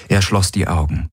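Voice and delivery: ordinary delivery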